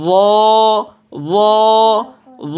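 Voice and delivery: "র" as a full, rounded zhaw, held a beat; thick, lengthened "র"; thick heavy letter. Shown as a demonstration. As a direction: draw "র" out with a heavy mouth. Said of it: "র মোটা করে দিয়ে পড়তে হবে".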